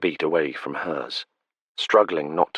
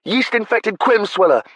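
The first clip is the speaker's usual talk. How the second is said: high, loud, relishing each word; gleeful repeat; 5 dB louder than usual. Acted. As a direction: shout it back with delight. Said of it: "delighted relish".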